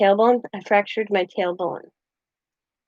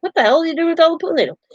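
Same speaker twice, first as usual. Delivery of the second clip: high, rushed mock exclamation; imitating incredulous question; 7 semitones higher, much faster than usual. Man confronting her over the pool noodles, mock incredulous. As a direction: fire it off fast and high, quoting someone baffled. Retold husband's startled question.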